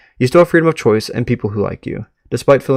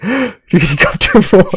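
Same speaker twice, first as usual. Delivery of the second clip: creepy voice; 8 semitones higher, 4 dB louder than usual. Acted. Friendly host turned creepy while greeting Chester, high menacing voice. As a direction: make the welcome sound sinister. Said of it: "Why am I making it sound creepy?".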